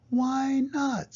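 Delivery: very high, drawn-out chorus reply; high sing-out "why not"; crowd's agreement. A narrator's reading. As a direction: swoop way up as all the townspeople agreeing. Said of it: "said all the people".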